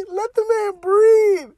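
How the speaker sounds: laughing it out, high and slow. Real, not acted. Podcaster laughing at his own joke. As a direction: crack up mid-line.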